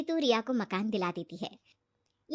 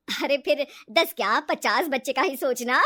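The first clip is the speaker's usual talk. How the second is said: jealous mockery; 6 dB louder than usual. Ritu jealous of her friend's plan, mocking. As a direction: scoff jealously, then cackle. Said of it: "जलन होती है".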